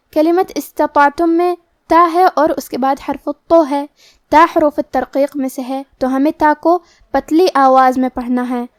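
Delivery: normal talking voice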